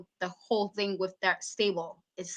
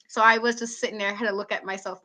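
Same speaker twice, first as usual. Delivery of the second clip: ordinary talk, nothing marked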